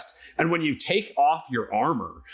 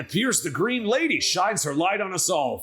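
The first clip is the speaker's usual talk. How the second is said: higher, blessing-like and glowing; Erden Meyer; 7 semitones higher than usual. Acted. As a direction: bestow the lady's light warmly. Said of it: "Erden Meyer".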